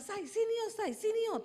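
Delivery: high, loud calling out, acted; imitated yelling. Husband urgently calling his wife to come see, imitated yelling. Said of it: "Dia teriak-teriak".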